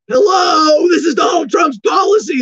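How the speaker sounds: high, loud, incredulous hello; exasperated shout. Real, not acted. Ranting host shouts at absurd anti-Biden protest, exasperated yell. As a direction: yell it like the answer is obvious.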